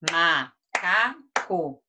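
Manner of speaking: "macaco" slowly split as ma, ca, co; syllable by syllable. Shown as a demonstration. Teacher claps out the word with pupils, syllable by syllable. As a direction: give "macaco" one separate beat per clap. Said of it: "Vamos bater palma".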